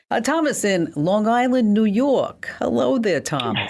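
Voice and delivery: high, lively greeting with a hint of New Jersey; semi-New Jersey accent. Real, not acted. Radio host greeting a caller from Long Island, semi-New Jersey accent. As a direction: let a little New Jersey slip out. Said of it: "that was my semi-New Jersey accent coming out".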